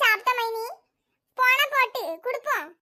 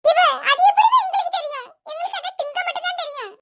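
laughing retort; 6 semitones higher, 5 dB louder than usual. Acted. Mocking the absurd chicken claim.

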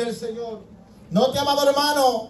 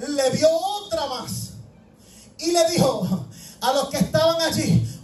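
ordinary delivery

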